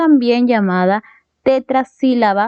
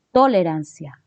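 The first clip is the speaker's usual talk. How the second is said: "Tolerancia" stressed on TO; "Tolerancia" misaccented on its first syllable; wrong first-syllable stress; 2 semitones lower than usual. Shown as a demonstration. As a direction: hit the TO of "Tolerancia" and let the rest fall. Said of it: "no dices".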